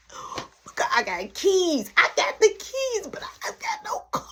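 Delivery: high-pitched acted panic; freaking out. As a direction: squeal it in a rising panic. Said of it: "I'm freaking out".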